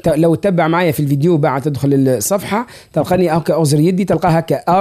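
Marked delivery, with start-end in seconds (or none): none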